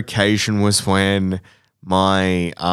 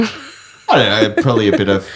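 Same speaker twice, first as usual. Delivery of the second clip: laughing. Real, not acted. Laughs while answering.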